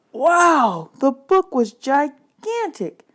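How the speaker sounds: loud, high, slow wonder; amazed wow. A narrator's reading. Boy seeing the gigantic book, loud and high with awe. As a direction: gape at the huge book out loud.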